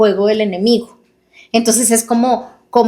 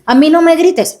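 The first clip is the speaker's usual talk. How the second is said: high, louder mock-angry snap; mock angry retort; 7 semitones higher, 3 dB louder than usual. Spouse snapping back in a staged fight, high and louder. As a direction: fire it back like a hurt spouse.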